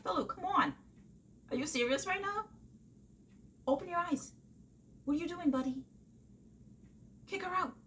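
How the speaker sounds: fed-up reader yelling at the book; exasperated at a character. Retold or imitated. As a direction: plead with a fool on the page. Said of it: "that was me the entire time".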